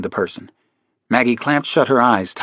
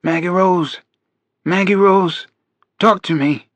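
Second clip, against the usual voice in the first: high urgent call through a door; 8 semitones higher than usual. A narrator's reading. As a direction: call her name, pleading. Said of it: "called out her name".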